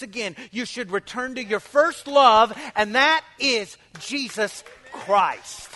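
loud, fervent preaching. Acted. Pastor preaching loudly to his congregation, fervent. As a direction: preach it with full force to the church.

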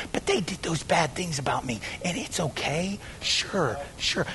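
very soft mock justification; hushed excusing. Sinner justifying talk about those people, hushed excuse. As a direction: sink low and soft, letting yourself off. Mimicking self-excusing gossip.

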